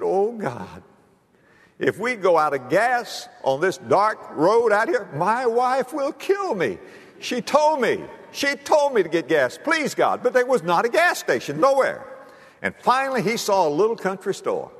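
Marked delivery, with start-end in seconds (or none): 0.0-10.2 higher, slow pleading panic; mock desperate prayer of a scared husband; panicked prayer. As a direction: beg God not to run out of gas. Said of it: "he begins to panic".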